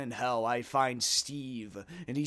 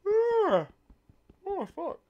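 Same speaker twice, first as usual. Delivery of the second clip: yawning; 7 dB louder, slower than usual. Real, not acted. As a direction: yawn through it. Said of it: "Sorry for fucking yawning".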